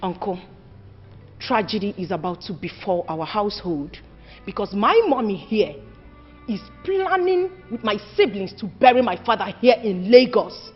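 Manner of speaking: low, soft, solemn report; grave warning. Acted. Daughter warning uncle of impending tragedy, solemnly. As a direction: break it to him gravely, keeping low.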